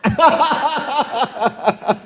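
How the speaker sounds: high burst of laughter; preacher laughing with the congregation; hearty laugh. Real, not acted. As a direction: crack up at the joke. Laughs at own joke.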